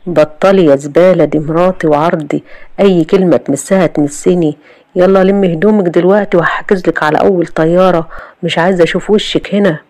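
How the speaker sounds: plain reading voice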